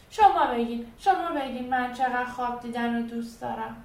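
she is outraged at being called scared; indignant doll. Acted. Doll asks other dolls for support.

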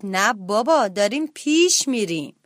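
plain reading voice

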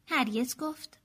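plain reading voice

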